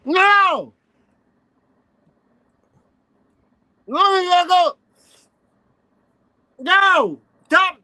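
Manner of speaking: loud, high yelling no. Real, not acted. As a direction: shout him down. Heated argument, yelling no.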